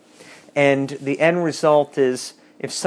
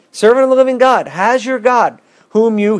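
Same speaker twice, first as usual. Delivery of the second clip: high, loud, desperate cry into the den; anguished call; 8 semitones higher, 5 dB louder than usual. A narrator's reading. King calling to Daniel in the den, anguished cry. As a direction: cry out as if fearing the worst. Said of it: "he called out to Daniel in an anguished voice".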